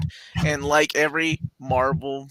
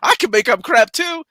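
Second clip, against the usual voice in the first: high, loud incredulous rant; retelling scoffing at a small-channel rumor, mock indignant; mock outrage; 9 semitones higher, 4 dB louder than usual. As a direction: act out your disbelief at a rival, hammed-up. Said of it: "And I was like".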